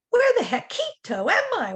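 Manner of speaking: high, loud puzzled question; bewildered wondering. A narrator's reading. Lost kitten arriving at a strange shack, high and puzzled. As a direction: sound lost and amazed. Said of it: "wondered Scapito aloud".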